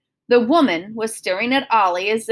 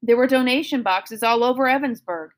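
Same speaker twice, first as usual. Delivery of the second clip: ordinary delivery, nothing marked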